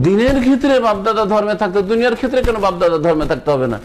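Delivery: ordinary talk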